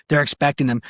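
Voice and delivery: normal talking voice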